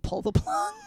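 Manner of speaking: very high, crying mock wail; hammed-up anguish. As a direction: wail it in fake agony. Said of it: "could not force the murderous words".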